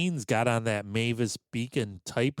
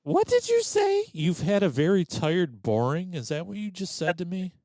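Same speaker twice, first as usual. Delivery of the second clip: mock outrage; 7 semitones higher than usual. Mock outrage at misheard line.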